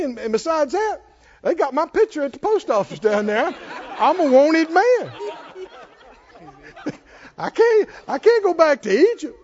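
a lightly voiced flustered Moses, half laughing. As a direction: play a stammering, nervous Moses through laughter. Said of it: "What did he say?".

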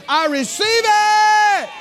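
high, slow, loud shout of thanks; joyful shout. Retold or imitated. Believer shouting thanks for God's gift, high and slow. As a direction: cry it out with full joy. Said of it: "you rip the paper off and you say".

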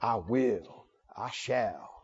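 very low, mocking pompous echo; mock disapproving critic. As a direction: intone it deep, as a scandalized critic. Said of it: "some people find fault with folks like me".

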